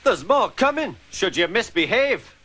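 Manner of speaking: high, scolding engine voice; angry Scottish engine. Acted. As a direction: tell the van off sharply. Donald warns the van.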